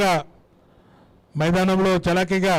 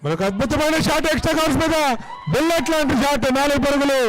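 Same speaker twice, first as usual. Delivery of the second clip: higher, excited call of a boundary; excited cricket commentary; 6 semitones higher than usual. Real, not acted. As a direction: lift the voice as the ball races away. Boundary four hit.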